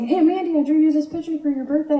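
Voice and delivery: put-on high, quick little-kid voice; excited kid showing off a present; six-year-old nephew. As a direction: pipe up like an eager small child. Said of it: "My nephew is six years old".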